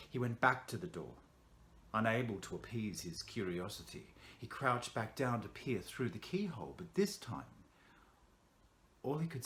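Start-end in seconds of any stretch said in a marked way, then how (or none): none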